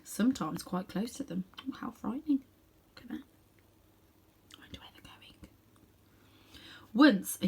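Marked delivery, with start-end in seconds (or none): 1.7-2.4 hushed, pretend fright; mock-frightened whisper. Reader whispers to children in mock fright. 2.9-5.5 breathy whispered wondering; hushed whisper. A narrator's reading. Reader whispers wondering to the children.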